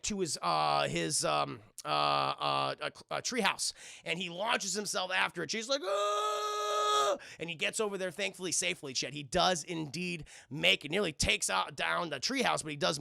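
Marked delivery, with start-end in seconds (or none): 5.8-7.2 high-pitched sustained "ah"; long mock scream. Kid launching himself on the pulley, long high scream. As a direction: imitate a yell while zipping away.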